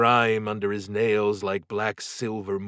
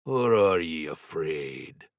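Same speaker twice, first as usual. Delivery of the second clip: low but sharp; 4 semitones lower, slower than usual. A narrator's reading. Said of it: "Paddy's voice stayed low but sharp".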